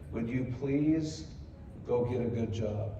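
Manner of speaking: a hint of the wife's tears as she begs; tearful plea. Imitating his tearful wife begging him to get a job. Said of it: "with big crocodile tears coming down her face".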